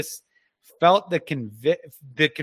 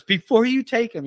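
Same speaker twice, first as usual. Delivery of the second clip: wife's plea, retold in a higher voice; higher, pleading imitation of a weeping wife; 8 semitones higher than usual. Wife begs husband before he takes their son, weeping plea retold. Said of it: "she's weeping over him".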